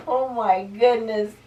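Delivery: ordinary delivery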